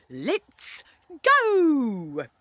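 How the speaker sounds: drawn-out shouted go; calling out. A narrator's reading. Stern man orders kid to drive off, calling out. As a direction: launch the last word high and loud.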